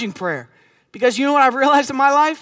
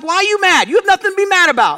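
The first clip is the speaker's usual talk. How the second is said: raised-pitch, exasperated retold scolding; mock mad; 9 semitones higher, 5 dB louder than usual. Husband reacting to wife's bad mood, acted irritation. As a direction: hurl it as an indignant complaint, pitched up. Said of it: "I'm mad that she's mad".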